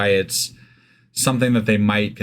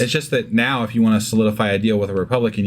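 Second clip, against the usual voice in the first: ordinary talk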